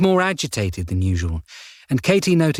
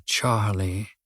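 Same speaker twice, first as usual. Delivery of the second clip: Charlie dropped low and much softer, trailing away; name fading out; 3 semitones lower, 5 dB quieter than usual. A narrator's reading. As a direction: let the last Charlie sink to almost nothing. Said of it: "rolled the name around his mouth".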